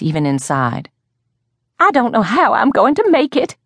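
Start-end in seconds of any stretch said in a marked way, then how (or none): 1.8-3.6 high-pitched, dramatic wail. A narrator's reading. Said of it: "she wailed".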